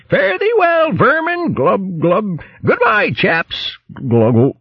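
posh hare gurgling as he's dragged off; spluttering hare. Acted. Hare spluttering farewell to the vermin, gargling high.

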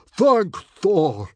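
huge booming relief; bellowing. A narrator's reading. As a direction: bellow it like a Viking chief. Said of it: "bellowed Stoic".

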